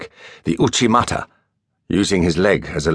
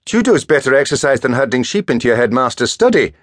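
higher, louder playful jab; teasing; 7 semitones higher, 4 dB louder than usual. A narrator's reading. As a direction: needle his friend. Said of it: "Marcus teased".

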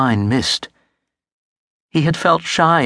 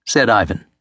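ordinary delivery, nothing marked

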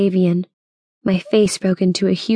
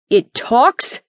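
incredulous question, pitched up at the end; bellowed; 4 semitones higher than usual. Acted. Bill with rifle leveled at the creature, mild bellow. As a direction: bellow it in disbelief, without full volume. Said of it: "Bill bellowed".

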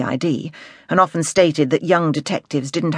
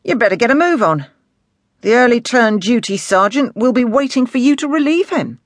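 higher, pointed order from a superior; inspector dismissing a subordinate; 8 semitones higher, 5 dB louder than usual. Acted. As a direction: send the sergeant off with authority.

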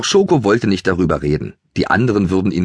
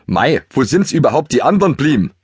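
higher, folksy Bavarian voice; Bavarian dialect; 7 semitones higher than usual. Acted. Haflinger Toni asks the ponies in a higher Bavarian voice. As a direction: chat like a Bavarian mountain pony.

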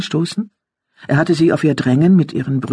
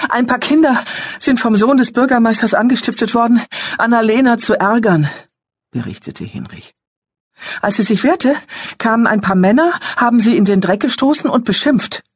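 higher, louder agitated youth; teenage boy voice; 10 semitones higher, 3 dB louder than usual. A narrator's reading. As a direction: report it like an upset teen. Older son voiced.

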